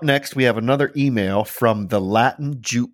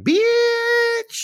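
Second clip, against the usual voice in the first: very high, stretched-out exclamation; long, high playful screech; mock-outraged squeal. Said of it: "I was just like".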